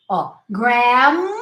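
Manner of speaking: ordinary delivery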